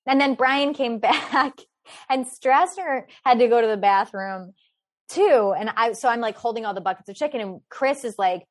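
ordinary talk, nothing marked

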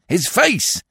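high, pressing repeat; insistent demand. A narrator's reading. Detective demands a description of the face, insistently. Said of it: "demanded the detective".

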